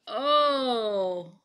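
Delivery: loud, drawn-out moan of "oh"; storybook moan of woe. A narrator's reading. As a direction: groan it out like a dazed character. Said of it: "moaned Bullfrog".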